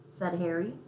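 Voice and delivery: ordinary delivery, nothing marked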